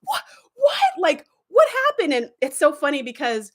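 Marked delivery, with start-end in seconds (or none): 0.0-2.1 squeaked what, far higher; a stunned, high-pitched disbelief; shocked what. Retold or imitated. Host reliving the cliffhanger as a kid, a shocked high squeak. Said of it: "being like".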